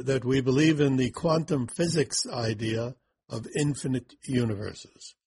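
usual speaking voice

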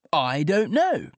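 gamely playing along with a riddle. A narrator's reading. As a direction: play along gamely, slow and high. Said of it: "he said gamely".

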